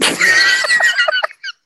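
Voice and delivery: high shrieking laugh; loud burst of laughter. Real, not acted. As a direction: let out a big squealing laugh. Laughing after DJ bit.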